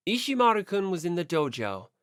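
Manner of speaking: ordinary talk, nothing marked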